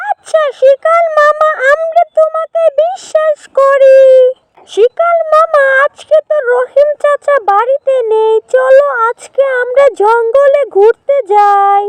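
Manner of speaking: high-pitched, eager bunny voice; trusting little rabbit. Acted. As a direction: play a rabbit agreeing to an outing.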